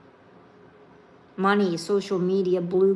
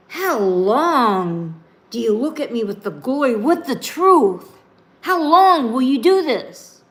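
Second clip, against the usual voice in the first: Jesus confronting the disbelieving crowd, louder and higher; exasperated lament; 3 semitones higher, 6 dB louder than usual. Acted. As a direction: plead with mounting frustration.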